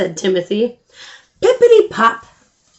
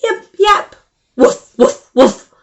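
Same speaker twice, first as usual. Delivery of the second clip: high yips then loud woofs; playful dog barks; barking dogs; 10 semitones higher, 6 dB louder than usual. Acted. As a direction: bark like little dogs. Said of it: "All the dogs began to bark".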